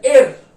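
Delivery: एर said loud and clear; German er. Shown as a demonstration. Teacher demonstrating German letter एर. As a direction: give एर a clear German ring. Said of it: "इसको क्या pronounce करते हैं".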